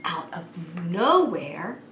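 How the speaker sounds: ordinary delivery, nothing marked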